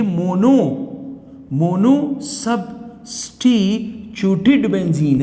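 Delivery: each syllable stretched out slowly; slow classroom dictation; slow and spelled out. Shown as a demonstration. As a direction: drag the long name out so students catch it. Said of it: "इसे क्या कहते हैं हम?".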